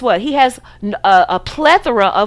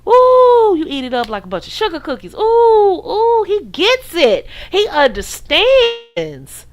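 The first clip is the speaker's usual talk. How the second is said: squealing "Ooh" way up high, then a slow dreamy sigh of "he understands"; mock swooning fan; 8 semitones higher, 3 dB louder, slower than usual. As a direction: ham up a lovestruck admirer. Parodying a smitten follower.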